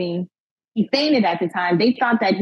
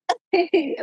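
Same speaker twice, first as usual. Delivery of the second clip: laugh; 9 semitones higher than usual. Real, not acted. Laughing at the shock.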